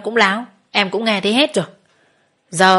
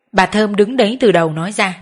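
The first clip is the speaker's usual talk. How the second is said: plain reading voice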